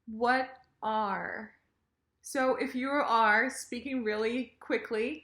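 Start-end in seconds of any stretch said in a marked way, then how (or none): 0.1-1.5 "what" and "are" as two separate full words. Shown as a demonstration. Teacher introduces the words, separated "what are". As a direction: pronounce "what", pause, then full "are". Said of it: "are the words".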